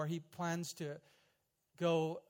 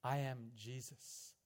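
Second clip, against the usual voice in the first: slow, soft and low revelation; the voice of Jesus answering the prisoner, hushed and slow; quiet divine voice; 4 semitones lower, 4 dB quieter, slower than usual. Retold or imitated. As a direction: let it fall gently, a quiet answer. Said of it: "the voice came back in his native tongue".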